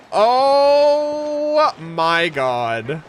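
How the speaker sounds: stunned awe. Real, not acted. Overwhelmed by the reveal.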